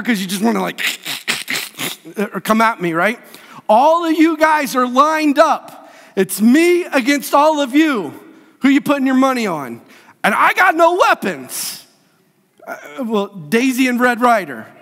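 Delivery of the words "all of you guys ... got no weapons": high-pitched, emphatic preaching. Pretend. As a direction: build up the impossible odds, raised voice. Preacher declaims mock battle odds.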